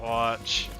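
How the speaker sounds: usual speaking voice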